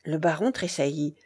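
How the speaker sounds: usual speaking voice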